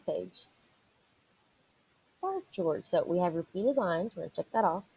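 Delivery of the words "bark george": high mother's call. Acted. Repeating mother's line, pitched way up.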